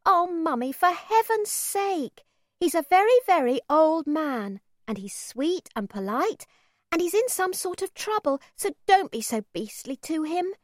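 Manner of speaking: put-on high, begging little girl voice; pleading child. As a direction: plead with mum, high-pitched. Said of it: "I wanted to say to her".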